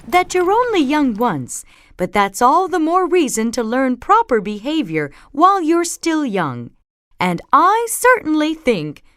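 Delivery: high, emphatic motherly lecture; prim, insistent mom; lecturing Mama Bear. A narrator's reading.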